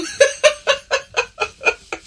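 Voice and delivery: high breathy burst of laughter; cackling laugh at the punchline; real laugh. Real, not acted.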